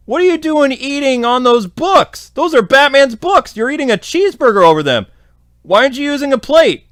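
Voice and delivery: high, outraged nagging about the books; indignant scolding. Retold or imitated. Beast Boy scolding Connor for eating over Batman's books, high indignant. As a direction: scold him like a fussy roommate.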